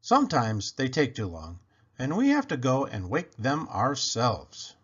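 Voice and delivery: plain reading voice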